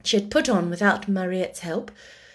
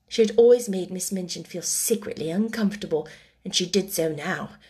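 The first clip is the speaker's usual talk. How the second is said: ordinary delivery, nothing marked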